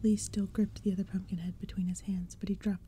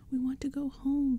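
higher, louder tearful plea; crying out; 6 semitones higher, 5 dB louder than usual. Acted. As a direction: plead to go home. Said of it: "Tabby cried".

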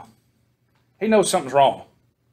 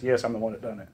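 soft, lower admission; quiet confession; 6 semitones lower, 12 dB quieter than usual. Retold or imitated. Achan owns up to the crowd, softly confessing. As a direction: hang your head and confess softly. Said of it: "Achan says".

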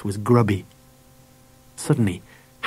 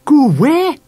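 high, loud alarmed question; gasped; 14 semitones higher, 5 dB louder than usual. A narrator's reading. Mam fears her son will leave, alarmed gasp. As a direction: gasp it out, pitched right up. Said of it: "gasped Mam".

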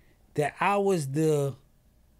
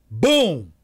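loud boom; 3 semitones higher, 13 dB louder than usual. Retold or imitated. Imitates the sudden crash.

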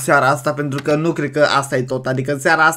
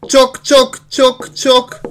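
knock knock; 7 semitones higher, 3 dB louder, much slower than usual. Retold or imitated. Knocking on the door aloud.

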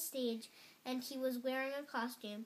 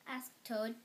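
ordinary delivery, nothing marked

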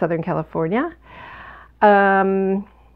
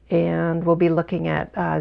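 ordinary delivery

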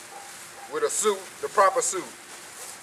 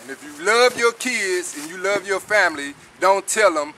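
ordinary talk

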